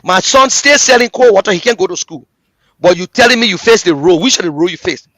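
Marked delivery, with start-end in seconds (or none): none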